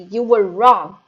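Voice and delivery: very loud bark. A narrator's reading. Headmistress barking at Eric, very loud. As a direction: bark the verdict. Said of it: "she barked".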